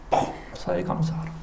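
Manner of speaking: whispered 범사에 감사하라; unvoiced echo of the sermon; whispered quote. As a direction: breathe 범사에 like a memory. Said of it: "목사님 뭐라고 설교".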